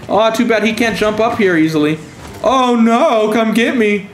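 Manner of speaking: high, hammed-up fake panic to bait the enemy; sarcastic high-pitched mock fear, baiting them to follow; mock terror. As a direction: squeal it like a fake scared victim. Taunting enemies with fake fear.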